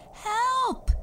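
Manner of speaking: very high, loud squeak; tiny boy crying help. A narrator's reading. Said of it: "he cried".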